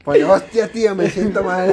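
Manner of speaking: put-on laughing, high Castilian accent; Spain Spanish accent. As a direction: play a hungover Spaniard through laughter. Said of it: "El acento, tío".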